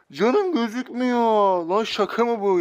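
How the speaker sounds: slow, high laughing disbelief. Real, not acted. Laughing in disbelief at his own missing health. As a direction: laugh through it slowly.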